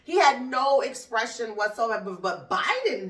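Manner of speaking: ordinary delivery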